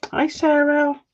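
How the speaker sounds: ordinary delivery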